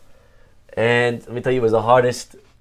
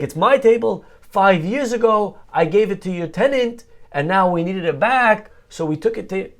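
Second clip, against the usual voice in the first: mock indignant; 8 semitones higher, 3 dB louder than usual. Neighbor's retold angry claim.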